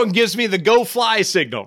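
ordinary talk, nothing marked

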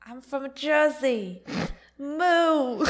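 high, slow mock New Jersey accent; hammed-up Jersey accent into a loud moo; Jersey Shore accent. Co-host channels reality TV, New Jersey accent. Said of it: "gonna channel Jersey Shore".

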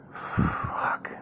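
long, breath-only swear; whispered curse. Real, not acted. Comedian reflecting on drugs in own life, whispered curse. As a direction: let it out softly on a heavy exhale.

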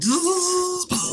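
high buzzing sound effect; psychic buzzing. Retold or imitated. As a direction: buzz like a psychic blast. Imitating psychic battle noise.

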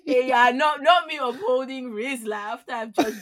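loud talk through laughter; laughing. Real, not acted. Host amused at upholding Rizla after dragging podcast, laughing. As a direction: crack up while saying it.

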